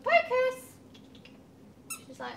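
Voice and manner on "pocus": high-pitched call to a cat; calling a pet. Real, not acted. Vlogger calling Pocus over, high pet call.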